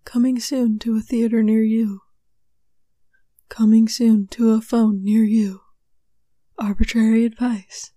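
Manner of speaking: loud, mock-dramatic trailer voice; punchy, deliberate trailer read; movie trailer announcer. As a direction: announce it like a cinema coming-attraction. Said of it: "trying to sound like one of those movie theater things".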